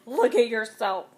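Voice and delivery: loud, furious spat command; mother spitting fury at her son before a mirror. A narrator's reading. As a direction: force him to look. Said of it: "I spat".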